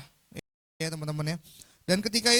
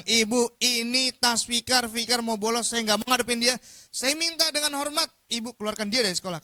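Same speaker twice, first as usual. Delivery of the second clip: loud, higher, hammed-up appeal; teacher's formal complaint; 4 semitones higher, 6 dB louder than usual. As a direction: plead forcefully to get the pupil expelled. Guru mengadu kepada kepala sekolah. (guru mengadu dengan suara lantang)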